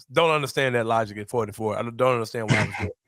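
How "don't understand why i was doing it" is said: ordinary talk, nothing marked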